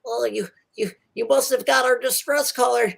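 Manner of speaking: high anxious gnome voice; high nervous tinkerer; pleading little gnome. Acted. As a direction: beg the heroes for help in a small high voice. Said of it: "the gnome says".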